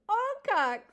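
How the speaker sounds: loud, high bellow at the owl. A narrator's reading. Porcupine calling the owl from his hole, loud bellow. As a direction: call him out loud and high. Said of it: "he bellowed".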